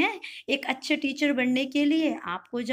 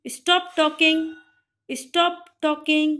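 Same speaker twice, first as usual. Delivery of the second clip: louder, slow stop talking; firm classroom command; 4 semitones higher, 7 dB louder, much slower than usual. Shown as a demonstration. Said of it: "क्या बोलोगे आप English में". (ज़ोर से और धीरे बोला गया आदेश)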